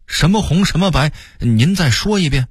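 high-pitched, sudden query; sudden questioning. A narrator's reading. Old Beijinger catches a familiar word, sudden questioning. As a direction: pitch jumps up, demand a repeat. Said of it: "突然说道".

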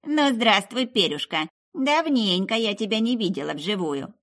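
loud high crone welcome; hearty greeting. A narrator's reading. Witch greeting Meryl, loud and hearty. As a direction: greet her like an old friend.

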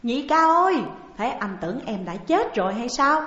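a loud pleading cry; calling out loudly. A narrator's reading. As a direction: call out to him across the room. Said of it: "kêu lớn rằng".

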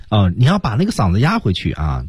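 ordinary talk

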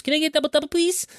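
high put-on voice asking for a double double; mock customer order. Imitating himself ordering coffee at the counter, high mock voice. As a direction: squeak the order like a chirpy customer.

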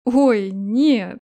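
hammed-up grimacing groan; mock disgust. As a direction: pretend to be put off. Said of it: "Наигранно скривился дракон".

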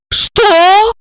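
high sharp cry; high startled shout at her friend's news; shouted what. Acted. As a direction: yell it out in shock. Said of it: "закричала она".

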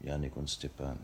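quiet. Acted. Ending the quarrel.

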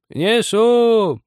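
very high, loud drawn-out reply; calling back. A narrator's reading. Old woman answers from the kitchen, high loud call. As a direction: sing out an answer from afar. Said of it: "отозвалась старуха".

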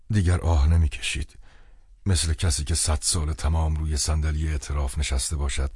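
ordinary delivery

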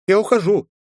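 put-on high, eager curious child; bubbly girl full of questions; little girl voice. Girl runs off.

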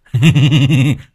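loud teasing cackle; mocking laugh. A narrator's reading. Son cackling while running off from dad. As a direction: cackle to taunt him. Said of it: "cười hình hạch trêu tức".

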